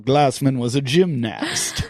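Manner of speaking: gymnast as a drawn-out "jim-NAST"; slowly mock gymnast with a full "nast"; mocked pronunciation. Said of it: "the way he pronounces gymnast".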